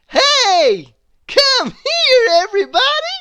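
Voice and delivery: high, loud shout to a crowd. A narrator's reading. Miss Trouble calling everybody over at the top of her voice. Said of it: "she shouted at the top of her voice".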